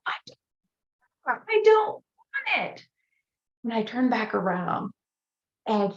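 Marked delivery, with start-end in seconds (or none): 0.1-2.9 much higher, slow furious yell; angry yell. Retold or imitated. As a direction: snap at the child to go away. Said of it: "I yell".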